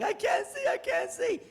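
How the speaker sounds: high yelling panic; panicked yell. Retold or imitated. Boyfriend stumbling blind in the kitchen, high panicked yell. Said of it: "and he's yelling".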